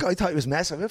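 usual speaking voice

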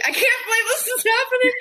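very high, strained mock wail; overwhelmed squeal. Reliving helpless laughter at dad's funeral, high overwhelmed squeal. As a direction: squeak it out as if losing it.

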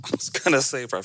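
normal talking voice

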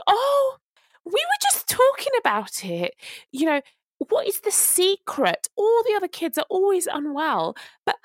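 high, sing-song fake-sweet voice; mock nosy nursery manager. Mother mimics the prying nursery manager, high fake-sweet. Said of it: "she's like".

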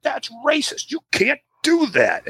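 high, slow mock outrage. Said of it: "all the Americans would be like".

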